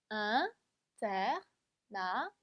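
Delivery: "international" very slowly, syllable by syllable; cut into syllables. Shown as a demonstration. Teacher cuts up a long word, "international", syllable by syllable. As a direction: chop "international" into separate syllables with pauses. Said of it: "한번 잘라볼게요".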